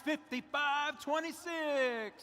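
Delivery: slow, drawn-out hype call; fighting game announcer. Acted. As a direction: stretch every syllable for the arena. Team number drawn out for crowd.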